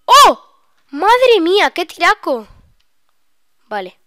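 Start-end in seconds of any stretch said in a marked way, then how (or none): 0.1-2.5 high, very loud astonished yell; amazed shout. Real, not acted. Player reacting to a great shot, amazed shout.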